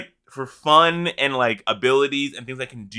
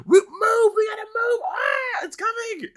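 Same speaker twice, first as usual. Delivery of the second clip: very high, squealed mock panic; panicked scream. Raider warning the group of the beam, panicked squeal. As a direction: squeal it out like a thin, distant scream. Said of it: "I've actually screamed".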